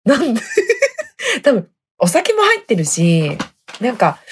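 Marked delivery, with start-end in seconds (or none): none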